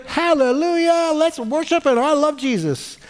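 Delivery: high, sing-song burst of mock praise; over-the-top high-pitched hallelujah; elated worshipper. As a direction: gush like a fair-weather believer. Mimics fair-weather believer on a high.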